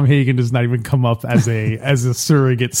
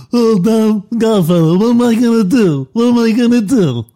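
much higher, louder whiny plea; imitating Johnny Fontane; 8 semitones higher, 6 dB louder than usual. Shown as a demonstration. Host plays Brando's Don mimicking Johnny begging the Godfather, high whiny plea. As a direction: wail it like a desperate crooner. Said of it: "That was me acting like Don Corleone, acting like Johnny Fontane".

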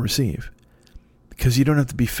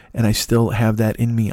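ordinary talk, nothing marked

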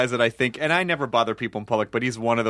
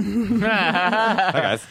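Michael Kors; 6 semitones higher than usual. Retold or imitated. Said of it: "he has the same greeting every time".

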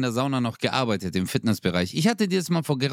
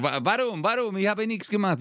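mock whiny innocence; 6 semitones higher than usual. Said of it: "Er so".

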